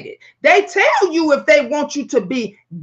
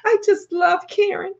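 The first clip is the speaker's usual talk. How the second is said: high, sweet, innocent mother voice; sugary TV mom; 8 semitones higher than usual. Retold or imitated. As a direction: play the doting mom pleading on a talk show, pitched up. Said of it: "that mother that goes on that show and say".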